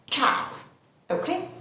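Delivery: normal talking voice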